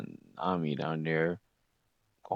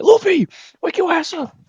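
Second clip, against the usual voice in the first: panicked yell; 10 dB louder than usual. Retold or imitated. Scared, waking Luffy up.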